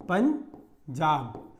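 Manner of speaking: पंजाब drawn out slowly, nasal ं held in पंजा; nasal anusvara demo. Shown as a demonstration. Teacher slowly sounding पंजाब for students. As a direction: stretch पंजाब, letting the ं hum through the nose. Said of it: "अब पंजाब में आपने पंजाब बोला".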